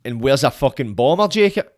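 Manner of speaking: ordinary delivery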